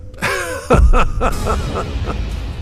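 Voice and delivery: high-pitched cackle, trailing down; mocking villain laugh. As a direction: laugh long and high at your cousin. Villain laughs at his cousin's words.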